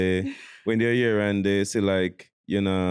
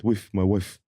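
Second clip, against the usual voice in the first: a hint of a put-on African accent; light mock African accent on whiff. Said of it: "With the, with the accent".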